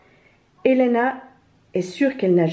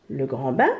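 low question swooping up at the end; astonished; 6 semitones lower than usual. A narrator's reading. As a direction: echo it in puzzled surprise, steep rise. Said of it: "s'étonne Elena". (question étonnée, du grave vers l'aigu)

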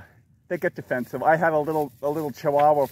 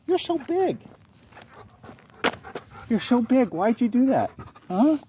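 talking to a dog; 6 semitones higher than usual. Real, not acted. Scolding dog after it lunged.

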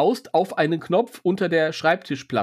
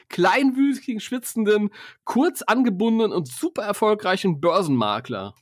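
higher, each adjective punched; building emphasis; 6 semitones higher than usual. Shown as a demonstration. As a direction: pile up the adjectives with relish. Hier kommt alles zusammen.

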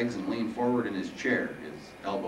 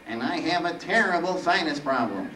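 higher, whiny nasal Reaper; nasal, weeny voice; 8 semitones higher, 4 dB louder than usual. Acted. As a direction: admit it in a stuffed-up weakling's voice. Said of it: "quite nasal and actually, well, kind of weeny".

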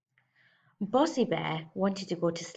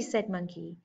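plain reading voice

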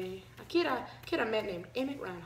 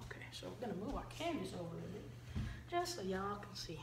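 whispering; 6 dB quieter than usual. Real, not acted. Moving canvas, quick aside.

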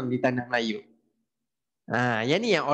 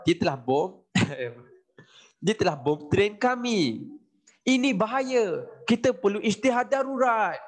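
higher lightly put-on official, hamming alarm; a hint of a pompous colonial voice rising to mock alarm; mock British official; 5 semitones higher than usual. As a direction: play a British officer declaring an emergency. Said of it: "And then British cakap".

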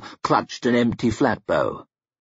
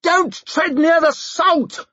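screeched. A narrator's reading. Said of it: "screeched Denker".

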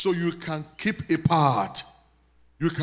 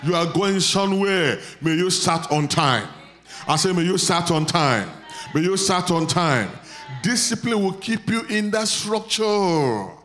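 loud, ringing prayer over the crowd; preaching at full force; 2 semitones higher, 7 dB louder than usual. Acted. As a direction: proclaim it like a revival preacher.

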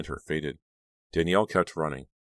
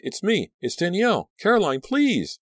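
very high, frantic begging; acted terror, pitch way up; shrieked plea; 5 dB louder than usual. A narrator's reading. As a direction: plead in panic to be recognized. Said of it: "Danielle shrieked".

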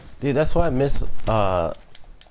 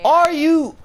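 loud, very high cry of disbelief; high-pitched gamer rage; outraged screech; 7 dB louder than usual. Real, not acted. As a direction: shriek it, cut short in outrage. Outraged at the game.

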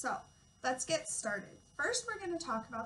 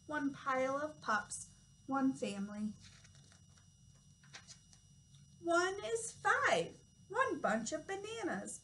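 put-on reading to children. Reads counting book aloud.

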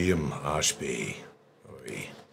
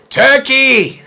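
high, loud shouted medic; 15 dB louder than usual. Pretend. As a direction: bellow it like a battlefield doctor. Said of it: "with a medic's voice".

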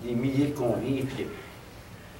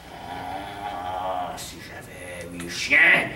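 groaning wish; 9 semitones lower, much slower than usual. Acted. Chained prisoner secretly summons his dogs, low groan building up. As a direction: start deep and slow, build to a cry on the last word.

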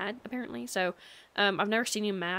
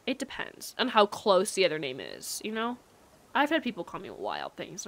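normal talking voice

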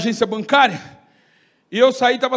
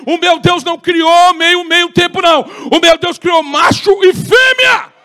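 loud, slow, rising shouted rebuke; retold shouted rebuke; 5 semitones higher, 9 dB louder, slower than usual. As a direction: bellow the rebuke at the sinner. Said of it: "ele botou a Bíblia na boca dela e falou".

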